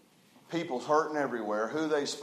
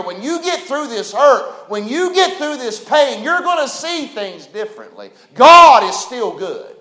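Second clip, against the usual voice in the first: loud, high preaching building to a shouted "God is still good"; 7 semitones higher, 11 dB louder than usual. Acted. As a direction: drive it home like a pulpit climax.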